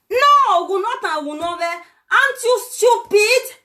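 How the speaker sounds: furious mocking jab; angry shout. Real, not acted. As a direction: scold them hard, stretching it out. Angry rant at viewers.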